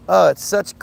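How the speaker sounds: ordinary talk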